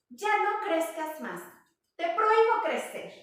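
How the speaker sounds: high, loud command; stern forbidding mother. Retold or imitated. Mother kangaroo scolding her growing son, stern and loud. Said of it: "voltea con cangurito hijo y le dice".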